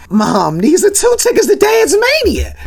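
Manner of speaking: very high, mock-hysterical excited squeal; overjoyed outburst. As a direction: play an overexcited son squealing at his mom. Acted son overjoyed at tickets.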